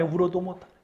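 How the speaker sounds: 울어도 못하네 dropped to a soft echo. Shown as a demonstration. Preacher echoes his lament softly to the congregation. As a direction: repeat it quietly as a fading sigh.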